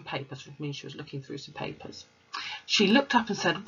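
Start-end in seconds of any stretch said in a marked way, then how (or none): none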